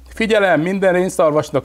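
high, loud megaphone call; police pup addressing the reindeer by loudspeaker; through a loudspeaker. A narrator's reading. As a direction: announce it over a loudspeaker. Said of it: "mondta a hangszórójába".

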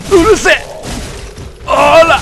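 high, loud snapping yell; angry shout. Real, not acted. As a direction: bark it at the enemy. Yelling at game enemy. (怒鳴り声で)

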